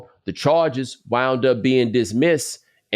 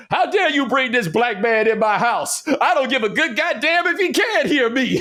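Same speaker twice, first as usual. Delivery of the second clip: angry Southern parent; 13 semitones higher than usual. Retold or imitated. Quoting an SNL holiday argument about a daughter's date, outraged high voice. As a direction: bluster like a scandalized Southern dad. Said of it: "Will Ferrell who was doing the voice".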